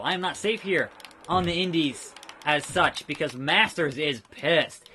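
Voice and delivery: usual speaking voice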